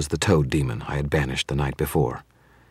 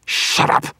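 hoarse, loud angry shout; strained furious yell; 5 dB louder than usual. A narrator's reading. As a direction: shout him down harshly. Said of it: "Victor shouted".

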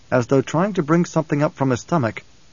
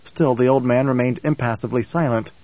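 usual speaking voice

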